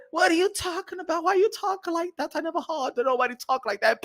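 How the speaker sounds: high-pitched, puzzled protest; lightly voiced woman, taken aback; bewildered woman. Woman shocked at how he talks, high and bewildered.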